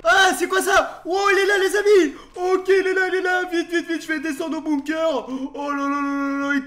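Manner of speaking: high, loud panicked yelling; panicked shout. Real, not acted. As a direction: scream it in sudden terror. Monster appears, flees.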